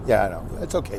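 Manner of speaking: usual speaking voice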